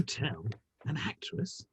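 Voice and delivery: hushed gossip, breath only; scandalised whisper. Acted. Gossiping about Reed's domestic arrangements, whispered. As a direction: lean in and whisper the question.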